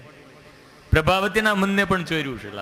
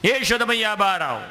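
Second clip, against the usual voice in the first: calling out; 7 semitones higher than usual. Acted. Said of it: "ત્યાં અવાજ કાને પડ્યો".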